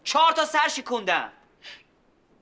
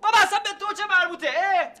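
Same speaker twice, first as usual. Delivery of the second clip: high, fast angry snap; angry retort; 8 semitones higher than usual. Acted. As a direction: bite back hard.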